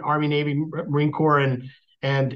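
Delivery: ordinary talk, nothing marked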